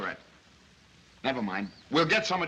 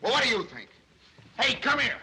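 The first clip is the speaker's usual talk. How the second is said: raised, higher-pitched irritated voice; yelling; 7 semitones higher, 4 dB louder than usual. Acted. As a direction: bark it at the nosy reporter. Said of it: "Stop yelling".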